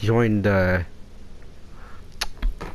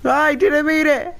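high pleading whine; 14 semitones higher than usual. Acted. Repeated pleading line.

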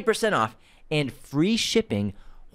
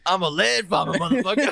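laughing mock boast; 8 semitones higher than usual. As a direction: crow through laughter at the friend.

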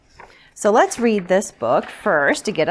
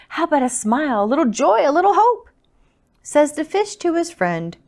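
lively, louder picture-book reading; story narration; 3 semitones higher, 4 dB louder than usual. A narrator's reading. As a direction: read it out rhythmically to little listeners. Reads picture book to children.

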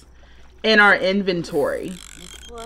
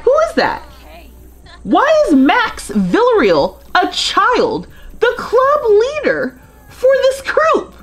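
much higher, incredulous squeals with laughter breaking in; shocked disbelief; 13 semitones higher than usual. Real, not acted. Player gasping at a child running her sim's club, squealing in disbelief. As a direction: pitch way up in stunned disbelief at the game.

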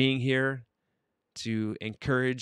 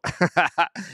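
high, quick chuckle; real burst of giggling laughter; amused laugh; 5 semitones higher than usual. Real, not acted. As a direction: laugh at a friend's joke. Amused by joke about likes.

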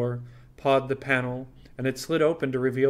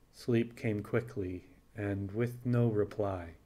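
usual speaking voice

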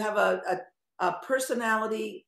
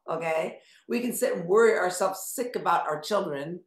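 ordinary delivery

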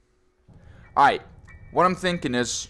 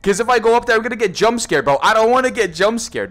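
loud, higher-pitched whining complaint; panicky protest; 6 semitones higher, 6 dB louder than usual. Real, not acted. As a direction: protest it loudly, dreading the scare. Protests at coming jump scare.

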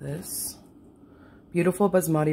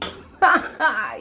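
1 high burst of laughter; 9 semitones higher, 4 dB louder than usual. Real, not acted. Laughs at own joke.

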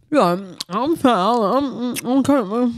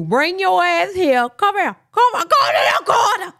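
mock scolding; 8 semitones higher than usual. To her friend, sending her to the corner: playful higher mock scolding. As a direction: boss her into the corner, half joking.

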